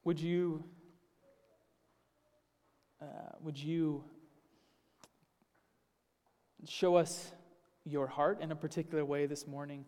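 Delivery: soft, hushed, unhurried prayer voice; quiet prayer. Acted. Pastor praying softly before the sermon, hushed and reverent. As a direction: pray gently, as if before the congregation.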